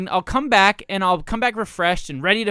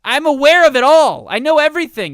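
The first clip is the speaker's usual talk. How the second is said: louder, higher, exasperated; defensive outburst; 8 semitones higher, 9 dB louder than usual. Real, not acted. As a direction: throw up your hands and protest. Defensive protest at host.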